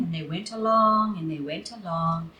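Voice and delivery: usual speaking voice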